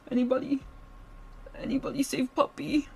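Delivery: put-on high, babyish plea for help; cute puppy plea. Captive calling herself puppy, begging anyone to save her, babyish. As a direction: whimper like a lost puppy.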